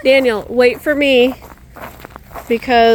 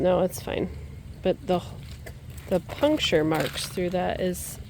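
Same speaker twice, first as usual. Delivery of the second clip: normal talking voice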